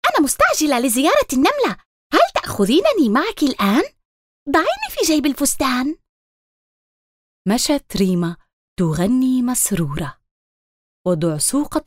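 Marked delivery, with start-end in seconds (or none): 0.1-6.0 high, fast little bug voice; hurried ladybug voice. Acted. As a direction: rush it like a tiny busy insect. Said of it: "قالت الدعسوقة الكسلى".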